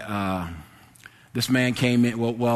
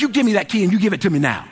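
fast, high, raised-voice order; angry retelling; 7 semitones higher, 5 dB louder, faster than usual. Retold or imitated. Clerk standing up from the desk, angry command. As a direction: act out laying down the law. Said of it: "that made me mad".

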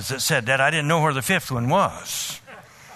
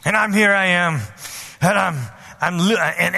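usual speaking voice

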